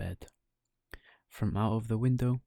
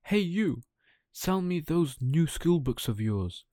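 a mild high call across the fair; 6 semitones higher, 3 dB louder than usual. Acted. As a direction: hail the boy from the table. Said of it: "He called".

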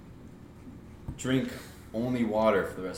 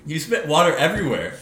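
startled laughing scold; 7 semitones higher, 7 dB louder than usual. Real, not acted. Friend spits water laughing.

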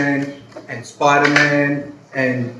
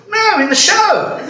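loud, much higher, drawn-out outburst; mock-exasperated cry, stretched and high; exasperated correction; 5 dB louder, slower than usual. Man correcting the confused priest, exasperated high cry.

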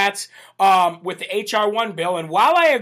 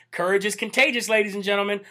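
ordinary delivery

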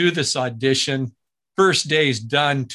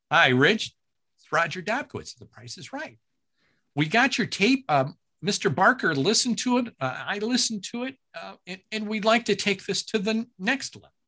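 higher, distinctive producer voice; Roger Dobkowitz impression; 6 semitones higher than usual. Producer phoning back with good news, higher imitation. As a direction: lift up into his recognizable sing-song. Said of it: "when I'm doing him".

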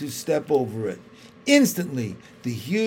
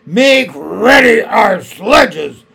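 slow, much louder commanding shout; barked order; 6 semitones higher, 13 dB louder, slower than usual. A narrator's reading. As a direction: command the dwarf at once. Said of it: "ordered the witch".

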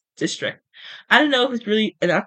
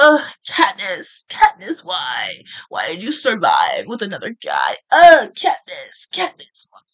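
mock mopey crying; 6 dB louder, slower than usual. Mocking a jilted Gale's moping to listeners, in a loud whiny wail. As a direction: moan the name like a heartbroken sulker. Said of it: "Gale's been moping around, crying".

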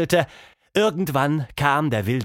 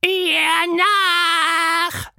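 very high, loud monster holler; bawling; 5 dB louder than usual. A narrator's reading. Quatschmonster urging his friends after the mouse, loud holler. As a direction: yell the chase order. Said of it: "krakeelte Quatschmonster".

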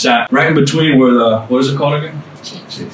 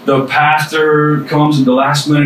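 ordinary talk, nothing marked

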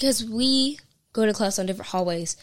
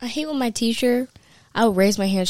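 usual speaking voice

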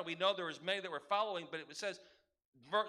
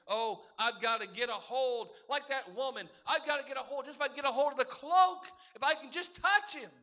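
reach for the cloak in desperation; desperate plea; 3 semitones higher, 5 dB louder than usual. Acted. Preacher voicing a desperate believer, building to a loud plea. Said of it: "desperate enough to say".

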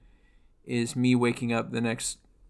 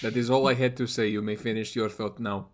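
husky Russian man voice; faster than usual. Acted. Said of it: "I sound like a husky Russian man".

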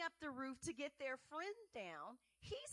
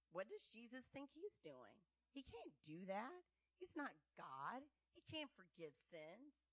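muttering scribes; 3 semitones lower, 7 dB quieter than usual. Acted. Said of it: "And the scribes say".

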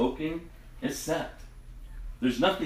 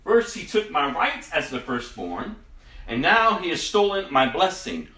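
higher, louder bitter protest; bitter exclaiming; 7 semitones higher, 4 dB louder than usual. Acted. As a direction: accuse the brother with rising anguish. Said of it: "And Esau exclaimed".

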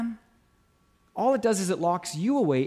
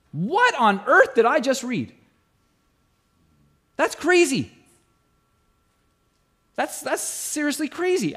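amazed; 6 semitones higher than usual. Real, not acted. Preacher reacts to verse read.

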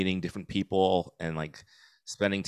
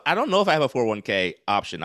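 ordinary talk

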